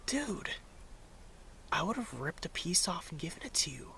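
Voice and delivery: soft incredulous whisper; hushed disbelief. Retold or imitated. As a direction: whisper it, half amused, half stunned. Retold reply to bread thief.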